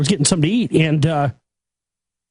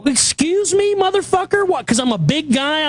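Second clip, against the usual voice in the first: angry shout; 7 semitones higher than usual. Retold or imitated. Retelling his outburst at the drive-thru worker, furious yelling. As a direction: tear into the worker with outrage. Speaker's angry retort retold.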